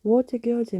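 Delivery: usual speaking voice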